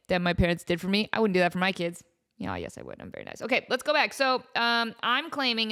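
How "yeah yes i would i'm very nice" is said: muttered aside. Real, not acted. Muttered self-correction aside.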